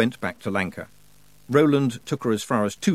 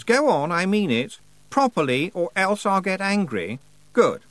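put-on a prim girl lecturing the squabblers; bossy Anagramma; 9 semitones higher than usual. A narrator's reading. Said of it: "the unmistakable voice of Anagramma at her most typically anagrammatical".

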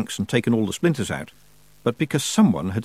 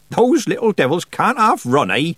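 put-on high, loud cackling old witch; a bawdy old witch teasing; jolly old witch calling out; Nanny Ogg voice; 9 semitones higher, 5 dB louder than usual. Nanny Ogg entering, chuckling.

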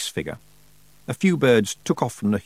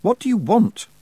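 high-pitched, challenging shout; shouted question; 11 semitones higher, 4 dB louder than usual. A narrator's reading. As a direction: demand an answer at a shout. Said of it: "Tiffany shouted".

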